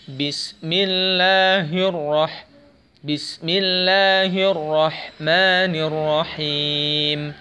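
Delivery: slow "Bismillahirrahmanirrahim" with clear breathy ha; clean recitation. Shown as a demonstration. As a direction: recite slowly, let the ha sound clean. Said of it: "harus bersih suaranya".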